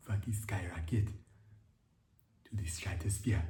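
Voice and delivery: slow breathy whisper, teasing; mock seductive whisper; sexy low voice. Shown as a demonstration. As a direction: purr it slowly in a seductive whisper. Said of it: "sexy, beautiful, low voice".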